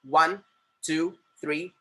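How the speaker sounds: short, crisp numbers; tight, firm counting. Shown as a demonstration. Teacher showing the correct finger count, tight and firm. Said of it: "कड़क रखना है finger को एकदम ऐसा tight".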